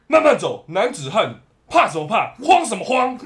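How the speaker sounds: loud, high barking rebuke; stern scolding. Retold or imitated. Officer scolds the panicking driver, loud stern rebuke.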